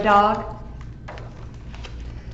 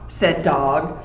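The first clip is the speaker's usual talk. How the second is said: usual speaking voice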